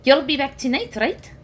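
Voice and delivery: put-on high, small child-like plea; small kittens. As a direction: ask like worried little kids. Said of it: "and they go".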